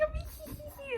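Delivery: high, soft tittering hee hee; a sly little giggle; giggling. Retold or imitated. Aunt giggling while taking pictures: high soft titter. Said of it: "she was just like".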